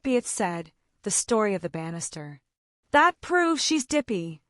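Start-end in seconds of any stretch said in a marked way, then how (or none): 2.9-4.4 slow, higher, louder gruff growl. A narrator's reading. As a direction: grind it out crossly. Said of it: "growled Uncle Benjamin".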